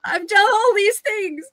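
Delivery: high, slow, whimpering; whiny pirate. Acted. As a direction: whine it like a grown man close to tears. Pirate whining about his efforts.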